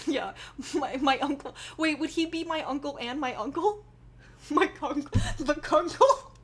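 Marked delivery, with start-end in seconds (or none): 4.5-6.3 "kunkle" squeezed out through a laugh, higher and louder. Real, not acted. Trying out a made-up family word, laughing. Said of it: "I hate myself for saying that".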